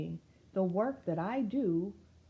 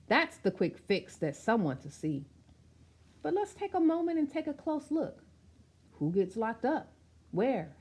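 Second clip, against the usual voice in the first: rhythmic, sing-song read of a picture-book poem; spoken-word storybook delivery; rhyming verse reading; 2 semitones higher, 5 dB louder than usual. Acted. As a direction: recite it with a steady bouncing beat.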